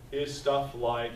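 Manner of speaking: normal talking voice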